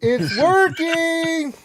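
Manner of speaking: high, loud, hugely drawn-out crowing; playful gloating. Pretend. Streamer teases co-host that annoying him works, gloating loudly. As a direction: stretch it out in mock triumph.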